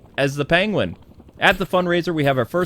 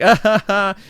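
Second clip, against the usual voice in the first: put-on high, loud ha-ha; hammed-up false laughter; mock fake laugh; 6 semitones higher, 5 dB louder than usual. Bruce brushing off the Penguin kid, fake laugh. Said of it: "And it's like".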